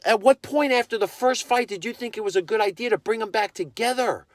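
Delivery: high, pointed demand to the owner; mock him with a disbelieving question; incredulous challenge. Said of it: "and I was like".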